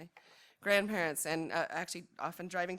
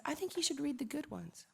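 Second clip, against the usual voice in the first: imitating daughter; 8 semitones higher than usual. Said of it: "my daughter Atalanta helped me pick some poems".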